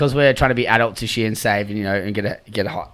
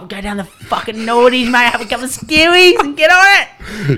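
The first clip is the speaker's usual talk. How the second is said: much higher and slow, exaggerated mate voice; mock party lad hyping the pub; hammed-up party lad; 11 semitones higher, 3 dB louder, slower than usual. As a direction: ham up the bloke planning a big night. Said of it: "I'm like".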